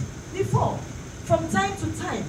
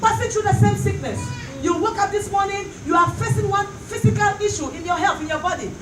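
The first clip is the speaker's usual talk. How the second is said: religious ecstasy; 4 semitones higher than usual. Real, not acted. Preacher building to a forceful climax.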